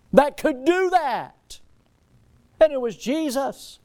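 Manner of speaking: high, loud and drawn out; slow preaching shout. Real, not acted. Preacher at the climax, slow and loud. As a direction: stretch it big from the pulpit.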